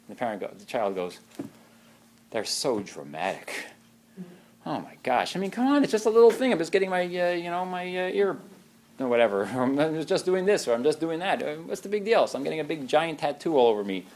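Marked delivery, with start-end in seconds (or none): 2.3-5.4 lower, slow, quieter eye-rolling sigh; a bored teen dismissing a parent. Retold or imitated. Preacher voicing a child mocking parents, eye-rolling. 5.4-14.0 put-on higher, fast whiny teen brushing it off; mock a careless kid shrugging; flippant teen. Preacher voicing a careless child, higher and whiny.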